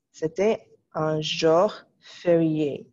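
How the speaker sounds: "c'était un jour férié" read slowly, each word apart; slow dictation. Shown as a demonstration. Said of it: "phrase numéro six".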